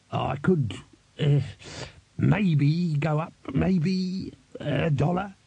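slow, halting, pitch climbing on each maybe; reluctant, forced out. A narrator's reading. Glod making the supreme sacrifice over money, painfully reluctant. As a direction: drag each word out against your will. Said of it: "each word fighting its way out".

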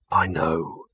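low, subdued grim reply. A narrator's reading. Victor answers Gaspode about the occupied seats, grimly. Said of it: "said Victor grimly".

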